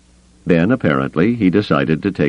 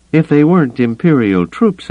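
high, louder call; calling after someone; 12 semitones higher, 5 dB louder than usual. A narrator's reading. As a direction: call out to stop him leaving. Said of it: "Mazik called after him".

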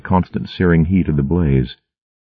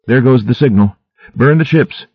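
yelled order; 8 semitones higher than usual. A narrator's reading. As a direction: call out across the shore to burn the ships. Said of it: "she yelled to Welko".

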